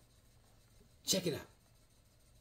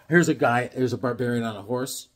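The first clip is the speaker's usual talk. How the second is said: ordinary talk, nothing marked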